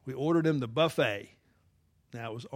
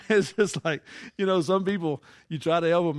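louder, higher, laughing it out; laughing exasperation; 5 semitones higher, 9 dB louder than usual. Real, not acted. Preacher laughs about the man never satisfied. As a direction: laugh off the ungrateful guest.

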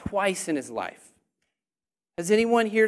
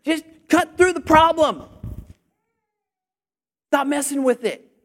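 raised, high-pitched preaching shout; yelling; 6 semitones higher, 5 dB louder than usual. Real, not acted. Preacher urges congregation to cut through problems, yelling. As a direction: yell it out as a forceful exhortation. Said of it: "Man, am I yelling too loud?".

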